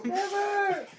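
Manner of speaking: high, loud battle cry; mock heroic shout. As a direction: yell it like a hammy hero. Joins the defiant joke.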